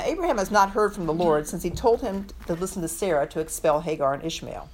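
normal talking voice

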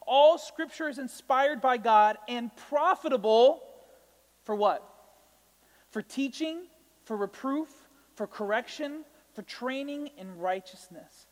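slow, emphatic reading of the verse; preacher reading the verse aloud; scripture reading. Acted. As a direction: read it out like holy text.

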